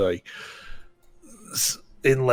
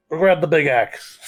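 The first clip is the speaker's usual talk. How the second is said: slightly loud, higher call to a helper; calling out an order; 5 semitones higher, 5 dB louder than usual. Acted. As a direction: call to the shop boy, a bit raised. Game master voices shopkeeper calling boy.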